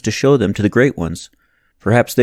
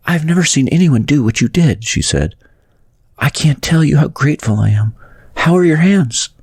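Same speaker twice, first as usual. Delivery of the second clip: higher woman's voice, full of thanks; grateful woman; 8 semitones higher than usual. Acted. Rescued woman thanking Adam, a higher grateful voice. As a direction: voice her gratitude, lifted and light.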